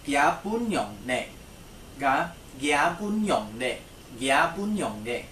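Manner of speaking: slow, careful repeated model; slow repeated classroom demo; slow model phrase. Shown as a demonstration. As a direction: say each syllable clearly for repeating. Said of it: "What about his wife?".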